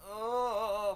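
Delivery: high, long-held exasperated groan; drawn-out groan. Real, not acted. Drawn-out noise while stuck under caution.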